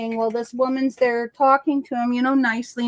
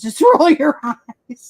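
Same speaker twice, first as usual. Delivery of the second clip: slow, louder, laughing through the words; laughing slowly; 3 semitones higher, 7 dB louder, slower than usual. Retold or imitated. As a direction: laugh it out, half exasperated. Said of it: "I'm like".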